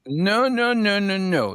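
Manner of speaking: high, rapid-fire string of objections; insistent interrupting. Real, not acted. Host stopping the others to make a point, insistent. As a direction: cut in high to take the floor. Cutting in to hold the floor.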